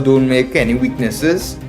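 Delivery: usual speaking voice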